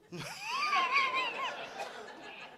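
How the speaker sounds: very high shrieking laugh; screaming laugh. Real, not acted. Comic shrieks with laughter at his own joke.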